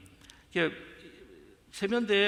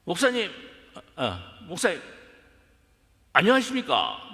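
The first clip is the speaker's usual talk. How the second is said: higher, louder mock Gyeongsang greeting; Gyeongsang accent; 3 semitones higher, 6 dB louder than usual. Second-gen youth greets pastor in Gyeongsang accent. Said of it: "경상도 말을 하는, 경상도 액센트".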